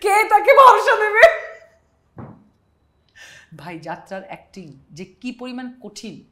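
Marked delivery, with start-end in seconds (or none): 0.0-1.5 much higher, louder, slow wailing lament; jatra dialogue. Acted. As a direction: wail it to the rafters, half laughing. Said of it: "যাত্রার dialogue".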